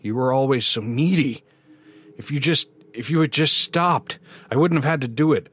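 high, building panicked protest; desperate blaming. A narrator's reading. Bill backs away from the voices, desperate rising protest.

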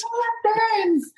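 high, drawn-out playful yell; stretched-out mock outrage; mock scream. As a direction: chime in with a slow, high holler.

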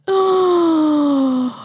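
big, high, drawn-out gasp; shocked gasp. Pretend. Host reacts to the eclipse prophecy, theatrical gasp. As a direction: gasp dramatically at a revelation.

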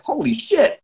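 high astonished exclamation; can't believe what he just saw; amazed. Retold or imitated. Watching dad amazed at the coach. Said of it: "the guy's like".

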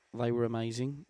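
normal talking voice

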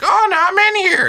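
put-on very high, loud old lady; hammed-up granny inside. Old lady announces she got in, very high.

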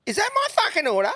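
hammed-up outraged woman shrieking; shrill mock outraged woman. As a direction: mimic her screeching at the counter. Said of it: "she's like".

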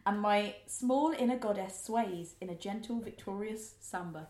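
slow, hushed mock-romantic reading; slow dramatic reading. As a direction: read it slowly like a swooning romance. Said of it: "here's one of my favourite lines".

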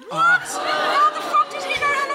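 high, loud cry of disbelief; outraged shout. Acted. Friend reacting to the runner's boast, shouting in outrage. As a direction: yell it out, scandalised and incredulous. Outburst at the hat man's boast.

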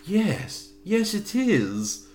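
mock Queen of England accent, very high and slow; full-on royal English, drawn out and exaggerated; Helen. Co-host mimicking Helen's reply to Roger, full-on Queen of England accent. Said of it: "like, Queen of England".

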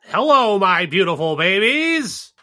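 slow, nasal-free hammed nerd greeting. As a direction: play a dorky nerd saying hello. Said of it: "in this nerd voice".

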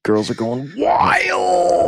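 high, drawn-out mock party holler; playful hype shout. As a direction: stretch it out in a big hyped-up yell. Playful hype about friend's looks.

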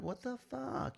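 high-pitched, hammed-up indignation; put-on scandalized voice; mock outrage. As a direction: squeal it as an affronted operative. Acts out offended woke operative.

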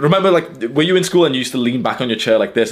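usual speaking voice

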